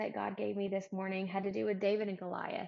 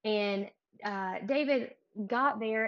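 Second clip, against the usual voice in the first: ordinary talk, nothing marked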